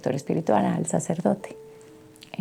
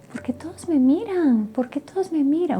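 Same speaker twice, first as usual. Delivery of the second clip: lightly voiced puzzled little girl, pitched higher; a hint of a bewildered child, higher; mother echoing her daughter's puzzled question, higher; light child's voice; 8 semitones higher than usual. Voicing her daughter waking up.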